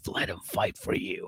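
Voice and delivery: breathy whisper of a secret thought; hushed mock whisper; whispered inner voice. Retelling her inner voice, whispered.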